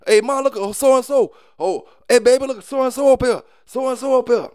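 high, eager call to the family about the news; a relative spotting someone on the news, high and eager; excited TV watcher. Retold or imitated. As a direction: point at the screen and call Ma over. Mock family member watching TV news.

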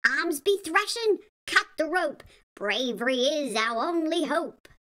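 put-on rhythmic chant for kids; sing-song read-aloud. A narrator's reading. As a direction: bounce it like a nursery rhyme.

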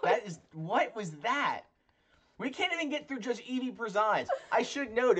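laughing. Real, not acted. Laughing at botched accent attempt.

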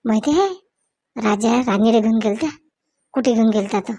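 ordinary talk

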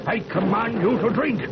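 stern command. Acted. Father commands daughter to drink.

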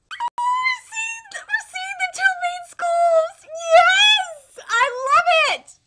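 high, loud, thrilled yelling at the screen; real fan squealing with joy; excited shout. Real, not acted. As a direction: scream with pure hype. Fan hyped over anime trailer.

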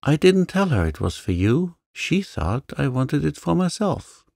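high plaintive moan. A narrator's reading. Janet confesses to angry Avery, high plaintive moan. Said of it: "moaned Janet".

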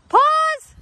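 high, loud sudden call of pause; shouted game command. Acted. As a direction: snap the kids into a freeze. Calls pause in freeze game.

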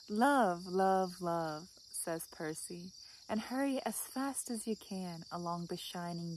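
soft, measured recital falling away at line ends; poem reading. Acted. Vlogger reads the dog Percy's advice, soft poem recital. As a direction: recite it gently, pausing after each image.

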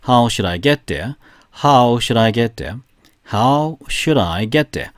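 very slow, even, word by word; slow repetition drill. Shown as a demonstration. Teacher leading the class drill, very slowly. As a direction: read it slowly three times. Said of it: "세 번 시작".

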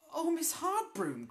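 high, loud dismayed cry; protesting cry. Acted. Ethel crying out against lending her broom. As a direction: protest in alarm. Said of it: "cried Ethel".

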